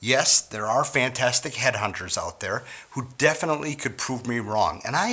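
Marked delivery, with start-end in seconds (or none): none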